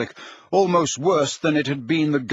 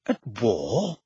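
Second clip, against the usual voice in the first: softer, low-key nervous question; 3 semitones lower, 5 dB quieter than usual. A narrator's reading. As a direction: echo it quietly, uneasy. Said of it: "repeated the Prime Minister nervously".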